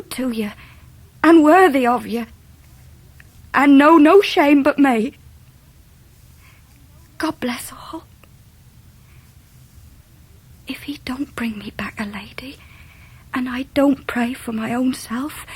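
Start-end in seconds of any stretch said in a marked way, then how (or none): 1.2-5.1 louder and louder, straining with feeling; rising anguish. Acted. Ham reading Emily's plea as his emotion breaks through, rising. Letter's climax read with emotion. 7.2-12.6 softly whispered, voice barely there; whispered reading. Acted. Ham reading Emily's farewell letter to her uncle, whispered.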